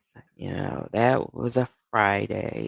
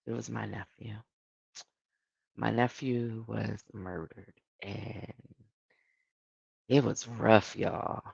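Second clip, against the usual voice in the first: grieving quietly; 3 semitones lower, 8 dB quieter than usual. Real, not acted. Tells of nephew's murder.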